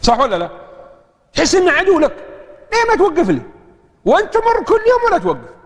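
high, impassioned sermon voice, pressing the point home; preaching at full force. Acted. Preacher rebukes listeners for never stopping to help.

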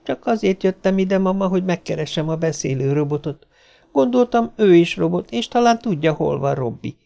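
put-on high, plaintive child's voice; sorrowful little girl. A narrator's reading. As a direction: explain yourself sadly to mama. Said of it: "Glória bánatos hangja alig volt hallható".

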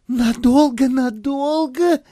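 high, breathless coy babble; babbling. A narrator's reading. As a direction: lisp it out like a giddy girl. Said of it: "пролепетала моя мучительница".

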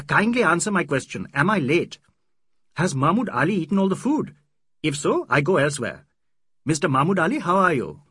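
impatient, playful demands to his friends; eager young doctor. Acted. Aziz voiced as excited young doctor.

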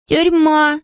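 put-on high-pitched child's outcry; dramatic high exclamation; exclaiming. A narrator's reading. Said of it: "воскликнул Патрик".

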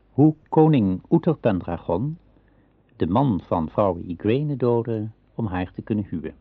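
usual speaking voice

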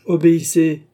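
secretary to chancellor, disdainful command; disdainful order. Acted. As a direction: order him with cold disdain. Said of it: "dit Beausire avec dédain".

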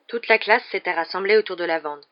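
usual speaking voice

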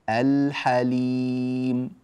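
الحليم with the ل sounded before ح; slow, sustained الحليم, long final vowel; clear lam demo. Shown as a demonstration. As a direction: model الحليم with ل lingered on. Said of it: "هكذا اللامات ظاهرة هنا".

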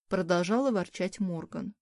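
ordinary delivery

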